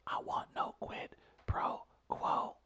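slow, voiceless, breaking whisper. Acted. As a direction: drop to a hushed, tearful whisper. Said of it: "he goes into that whisper at times".

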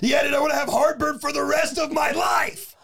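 high, loud, laughing sarcasm; laughing mock rant. Friend laughs off coffee with pizza, loud and mocking. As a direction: shout it through laughter.

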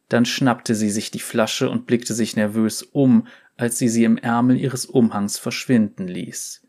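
plain reading voice

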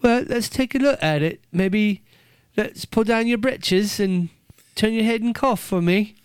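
high, mock English accent; examine a patient as a polite English doctor. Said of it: "somebody with an accent".

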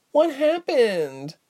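high, slow, timid little voice; mousy, hesitant question. A narrator's reading. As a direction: ask it timidly, high and small. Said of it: "Mouse asked timidly".

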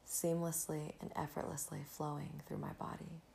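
breathy calm, guiding the listener inward; soft spa whisper. Acted. Leads a gratitude meditation.

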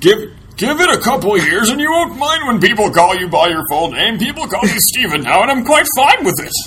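a hint of a wise old man giving advice. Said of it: "That's my old man voice".

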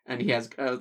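ordinary talk

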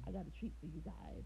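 Dave Chappelle. Retold or imitated. Comedian announcing a treat to his audience, lightly imitated low voice. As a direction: drop low for a hint of the comic. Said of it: "he said in his real Dave Chappelle voice".

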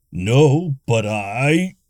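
rising frustration. A narrator's reading. Barbarian frustrated with the villagers, voice rising. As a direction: get heated, then get cut off. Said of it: "Gunther's voice rose in frustration".

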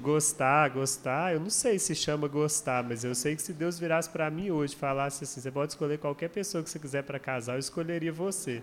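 soft, sincere, hushed confession; tender confession. Retold or imitated. Man confessing to her he would marry her, softly and sincerely. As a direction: lay your heart open gently. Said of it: "aí eu falei com ela assim".

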